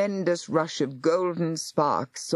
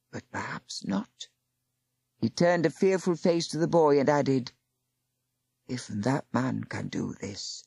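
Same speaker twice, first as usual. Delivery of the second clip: put-on fearful old Aufwader; 3 semitones lower, 3 dB quieter than usual.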